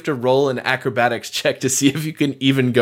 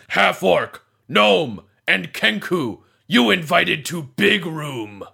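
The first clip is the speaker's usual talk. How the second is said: golem; 2 semitones higher, slower than usual. Acted. DM voices guard golem summoning.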